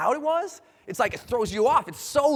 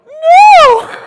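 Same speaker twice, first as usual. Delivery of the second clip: very high, loud drawn-out wail; terrified scream; 18 dB louder than usual. Retold or imitated. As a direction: scream the refusal in acted terror. Refusing during countdown to jump.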